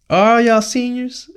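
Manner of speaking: high, slow mock cop question. Campus cop questioning the boys in the car, high mock voice. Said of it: "she goes".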